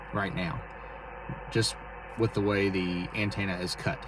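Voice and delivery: normal talking voice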